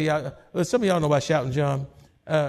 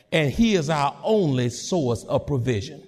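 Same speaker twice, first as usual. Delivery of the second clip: ordinary talk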